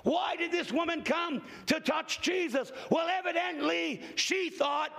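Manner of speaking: slow, high, shouted sermon cadence; full-force preaching. Acted. Preacher asking the congregation why the woman touched Jesus, shouting.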